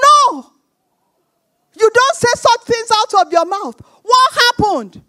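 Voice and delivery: loud, high-pitched scolding; forceful retold telling-off; emphatic rebuke. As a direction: shout the refusal, then scold firmly. Retold stern rebuke to caller.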